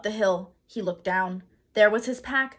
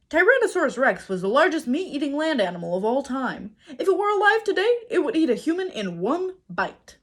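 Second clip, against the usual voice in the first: rising higher and louder as the facts get scarier; reading aloud in alarm; 7 semitones higher, 5 dB louder than usual. A narrator's reading. Jack reading the dinosaur book, more and more alarmed. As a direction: read the book's words with growing dread. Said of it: "He read".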